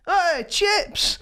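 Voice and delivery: high-pitched hail to a mate; retold calling out. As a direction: imitate a friend calling out, high. Friend calls out to supposed mate.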